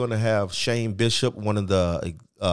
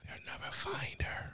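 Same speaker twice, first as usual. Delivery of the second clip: creepy whisper; 16 dB quieter than usual. Pretend. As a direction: whisper it like a killer.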